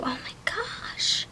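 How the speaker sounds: breathless, slow whispered gasp; awed whisper. Real, not acted. Reader gasps at the cards in a hushed whisper. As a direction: whisper it in awe, slowly.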